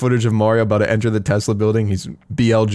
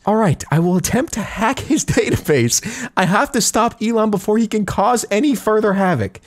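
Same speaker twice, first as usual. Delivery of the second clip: higher Mario, resolved; 10 semitones higher than usual. Retold or imitated. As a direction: promise it like a hero. Mario replies in voice.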